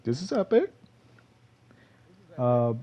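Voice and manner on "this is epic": high, hyped-up mock voice; excited. Gamer hyping himself up on his new drop spot, high and excited. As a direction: squeak it out like a thrilled gamer. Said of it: "I was like".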